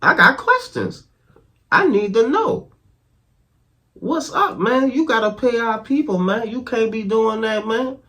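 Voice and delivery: high-pitched, exasperated pleading; heated demand. Real, not acted. Vlogger demanding the government pay our people, high and exasperated. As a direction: demand answers, fed up. Demanding answers about unpaid troops.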